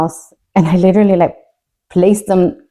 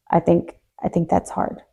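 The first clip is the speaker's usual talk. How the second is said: barely voiced, holding back tears; almost no voice, choked up; whispered through grief; 8 dB quieter than usual. Real, not acted. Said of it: "emotional admission, near tears".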